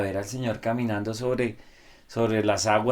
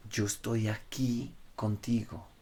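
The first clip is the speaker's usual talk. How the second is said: tender promise; 8 dB quieter than usual. Retold or imitated. Soft voiced promise of Jesus.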